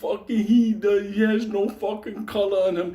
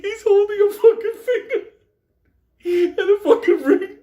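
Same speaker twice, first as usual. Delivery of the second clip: higher, louder, cracking up through the words; real laughter breaking the sentence; laughing; 13 semitones higher, 6 dB louder than usual. Real, not acted. Teller to audience about the finger and ring, laughing hard.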